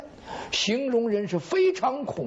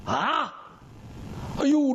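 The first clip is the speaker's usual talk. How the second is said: plain reading voice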